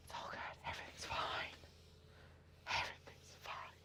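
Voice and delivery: soft whisper, telling herself it's fine; whispered reassurance. Real, not acted. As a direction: breathe the words out to calm yourself down. Reassuring herself while cutting.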